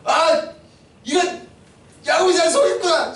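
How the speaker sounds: high-pitched dawning shock; shocked realization. Acted. Father realizing he was fooled, shocked exclamation. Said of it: "속았다는 걸 이제 한 거예요".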